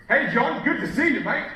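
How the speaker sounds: loud, high, booming hello; over-the-top loud, excited welcome; overpowering greeting. Acted. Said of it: "this person's freaking me out".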